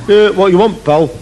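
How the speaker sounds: hammed-up Lancashire barman; northern barman. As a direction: bark it like a gruff local. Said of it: "who's actually from Rawtenstall".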